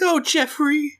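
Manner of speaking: high, cooing mum; doting mother. Acted. Mom to her son Blue Raja, doting. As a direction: coo it fondly up high. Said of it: "she's like".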